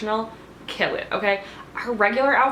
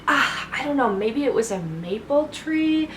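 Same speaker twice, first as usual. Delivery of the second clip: mock vague musing; 6 semitones higher than usual. Mimicking Rinna's vague improvised detail.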